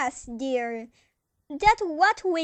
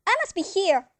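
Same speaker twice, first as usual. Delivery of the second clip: ordinary talk, nothing marked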